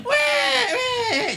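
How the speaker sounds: high wailing newborn cry imitation; baby cry. Preacher imitates newborn crying, high wail.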